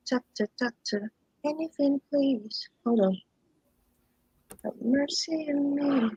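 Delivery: high, slow begging plea; mock pleading. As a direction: beg the doctor for mercy. Mock plea during screen trouble.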